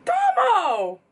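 high, loud cry of the name; excited outcry. Real, not acted. Fan reacting to [name]'s lyric, loud delighted outcry. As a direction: call out the name in shocked delight.

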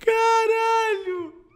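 high, slow drawn-out shout; amazed shout. Real, not acted. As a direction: cry out in disbelief. Exclaims after laughing fit.

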